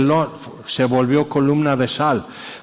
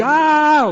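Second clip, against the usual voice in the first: shrill, high tantrum scream, much higher and louder; tantrum shriek; 13 semitones higher, 4 dB louder than usual. Retold or imitated. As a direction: imitate a child screaming in a tantrum. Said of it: "querías así volver loco".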